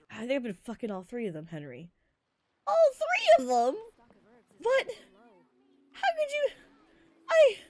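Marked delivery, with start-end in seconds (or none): 2.7-7.6 much higher and louder, outraged disbelief; betrayed crewmate gasping in shock. Acted. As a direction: squeak out the betrayal. Sailor learns Henry slept with all.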